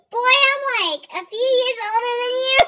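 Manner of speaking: high, loud mock outrage; hammed-up scolding at a high, loud pitch; indignant retort. Acts indignant retort to younger man.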